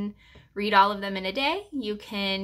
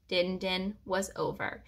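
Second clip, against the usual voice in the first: usual speaking voice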